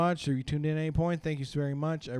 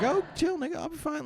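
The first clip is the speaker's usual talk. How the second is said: put-on much higher, childlike squeak; little brother's voice; 12 semitones higher, 3 dB quieter than usual. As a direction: pitch way up like a little kid. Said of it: "then he'd be like".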